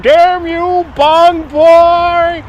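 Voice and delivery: high, loud mock-outraged cry; detective's angry catchphrase. Quoting the TV detective, a hammed-up outraged yell. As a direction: shake a fist at Bong Boy. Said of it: "he was like".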